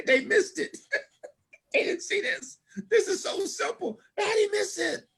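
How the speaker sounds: very high, squeaky laughing voice; giggling. Acted. Giggler marvelling at those who missed it, laughing high. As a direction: helplessly giggle through the words. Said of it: "you be giggling".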